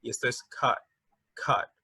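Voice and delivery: "caught" said softly as kat. Shown as a demonstration. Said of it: "es un poco más suave la pronunciación".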